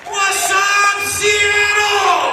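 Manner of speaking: high, loud, drawn-out yell; rock-star crowd greeting. Pretend. Guest playfully greeting the crowd, big mock-rockstar shout. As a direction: holler it out like a stadium headliner.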